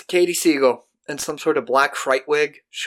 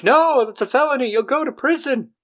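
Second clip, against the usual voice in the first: high-pitched scolding conscience; 11 semitones higher than usual. Retold or imitated. Conscience warns Marty.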